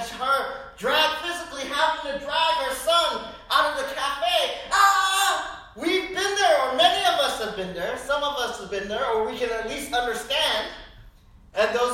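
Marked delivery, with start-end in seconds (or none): 4.7-5.7 loud mock cry; high childish wail; hammed-up toddler cry. Toddler dragged out.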